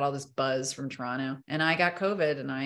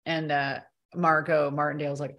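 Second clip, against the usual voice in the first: usual speaking voice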